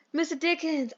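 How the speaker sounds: ordinary talk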